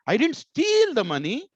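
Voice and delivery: "steal" stretched long and pitched high; stress on steal. Shown as a demonstration. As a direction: draw out "steal" with a big high swell. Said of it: "I will put more stress on steal".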